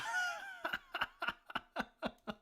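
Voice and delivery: a sudden high burst of laughter, shrieking. Real, not acted. Big laugh on stream.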